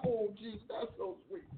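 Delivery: soft, low, tender coo; hushed tender sigh of delight; tender aside to Jesus. Retold or imitated. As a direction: coo it sweetly to a loved one. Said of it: "I'm like".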